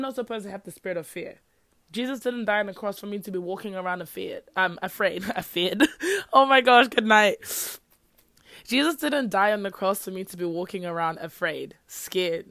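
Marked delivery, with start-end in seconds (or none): 5.4-7.4 loud, amused outburst through laughter; laughing at own slip. Real, not acted. Host tripping over afraid on her podcast, laughing loudly. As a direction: crack up at your own flub.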